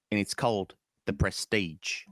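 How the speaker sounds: measured Cockney delivery; Michael Caine. Shown as a demonstration. Magician's mentor naming the trick, Cockney accent. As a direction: announce it in a London accent. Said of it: "That's your Michael Caine".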